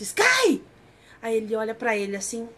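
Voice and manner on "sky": high, loud shout of a name; retold shout. Pry yells at the motionless Sky, shouting. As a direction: imitate a sudden yell to snap someone out of it. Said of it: "Aí ele dá um grito".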